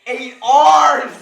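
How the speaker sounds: frustrated shout. Real, not acted. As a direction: yell it out in exasperation. Acting out charades, frustrated.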